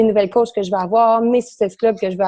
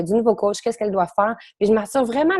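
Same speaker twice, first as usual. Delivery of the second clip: usual speaking voice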